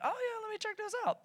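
high-pitched, soft, sucked-in interest; eager curiosity. Retold or imitated. Scrolling Facebook and caving to a list, eagerly curious. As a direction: pitch it way up, quietly tempted. Acts out getting sucked into lists.

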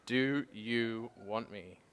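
slow, level, drawn out; slow gentle asking. Retold or imitated. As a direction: ask it slowly, letting each word land. Voice of God asking, repeated.

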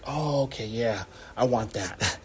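low, hushed voice of dawning agreement; quiet acceptance. Acted. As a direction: drop soft and low, slowly giving in. Imagined believer quietly coming round.

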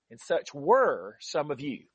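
"were" stretched out slowly; slow reading, weight on "were"; stress on "were". Real, not acted. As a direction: linger on "were", then let the rest fall away. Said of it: "I'm emphasizing the word were".

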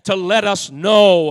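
loud, rising sermon cadence; preaching at full force. Acted. Pastor preaching loud to his congregation. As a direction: preach it out big to the church.

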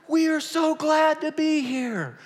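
high, loud, slow gushing; high, slow, loud mock-sugary announcement; syrupy emcee. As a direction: lay on the sweetness. Sweet stage announcement.

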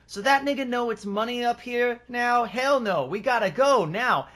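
high-pitched imitated yell, last words slowed; mock pimp scream. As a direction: ham up the pimp's panicked yelling, pitched high. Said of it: "Now the pimp screaming".

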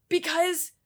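high, meek excuse with a laugh; sheepish good girl. Retold or imitated. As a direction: squeak out the innocent excuse. Sheepish excuse to annoyed husband.

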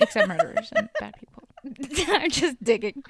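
high, loud, laughing through it; giggling loud high aside. Real, not acted. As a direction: laugh out the joke. Joking while laughing.

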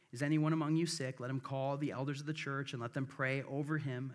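ordinary delivery

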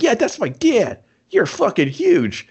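put-on high, slow starstruck kid voice; kid's voice. Kid gushing to the arm wrestler about his dad. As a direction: gush like an awestruck kid.

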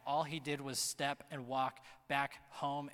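ordinary talk, nothing marked

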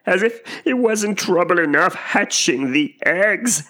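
high, slow bird complaint; drawn-out wail of a harassed mother bird; aggrieved pigeon. A narrator's reading. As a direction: grumble in a high, put-upon pigeon voice. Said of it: "said the pigeon".

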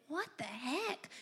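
mother's quiet inner puzzlement; baffled murmur. Retold or imitated. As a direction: say it under your breath. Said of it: "And I'm just thinking".

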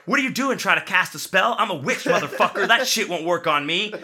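loud, high, comic bluster; mock outrage at full volume; hammed-up defiant shout. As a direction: shout him down, playing it for laughs. Retelling own challenge, played for laughs.

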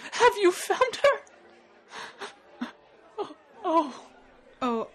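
very high, loud, slow wail through tears; sobbing mother. Acted. Mother pleads with the knight for her lost child, sobbing. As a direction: break down as you beg for news. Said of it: "her face contorted with tears".

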